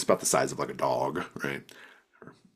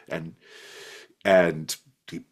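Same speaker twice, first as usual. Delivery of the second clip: ordinary talk, nothing marked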